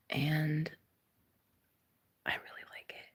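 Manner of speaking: breathy hushed confession; whisper. Retold or imitated. Neighbor confessing he likes her accent, whispered. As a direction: whisper it like a secret. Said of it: "he goes".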